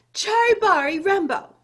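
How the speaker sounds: high, broken fragments with gasps between; gasping. Acted. Chang breathless from running, gasping to mother. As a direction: gasp out the garbled name. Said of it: "he gasped".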